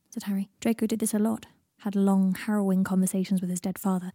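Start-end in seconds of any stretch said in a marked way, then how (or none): none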